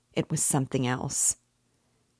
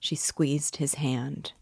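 ordinary delivery, nothing marked

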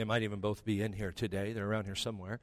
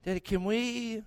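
put-on high, sing-song begging child; butter Dad up like a kid; wheedling daughter; 10 semitones higher, 3 dB louder than usual. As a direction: daughter coaxing her father for a favour. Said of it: "I can feel the manipulation coming".

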